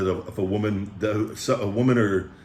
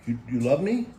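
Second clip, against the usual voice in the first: ordinary delivery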